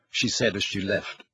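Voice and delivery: ordinary delivery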